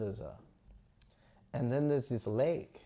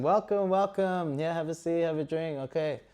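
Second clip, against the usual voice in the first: higher-pitched, effusive host voice; hearty welcome; 6 semitones higher, 4 dB louder than usual. Acted. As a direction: usher a guest in, settling gradually. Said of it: "Duryodhana welcomes him".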